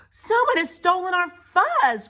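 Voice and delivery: high, loud outcry; alarmed cry. Acted. Pipsqueak announcing the theft to everyone, alarmed cry. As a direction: cry out the shocking discovery. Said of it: "cried Pipsqueak".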